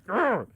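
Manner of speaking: high, loud playful growl; mock growl. Retelling a clash with coworkers, a mock growl. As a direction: growl like an angry dog at them. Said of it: "I'm like".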